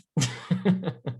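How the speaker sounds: short sheepish chuckle; embarrassed laugh. Real, not acted. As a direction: laugh it off after getting worked up. Embarrassed laugh after outburst.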